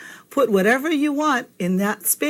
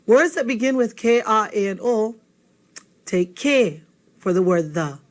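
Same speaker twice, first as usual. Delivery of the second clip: ordinary talk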